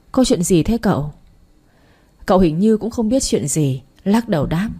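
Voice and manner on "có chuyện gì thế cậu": usual speaking voice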